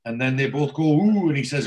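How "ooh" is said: a high, loud, impressed "ooh"; mock ooh. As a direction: coo it like two blokes impressed by the song. Both react to a song title.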